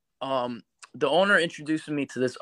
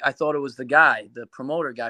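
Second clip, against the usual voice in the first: normal talking voice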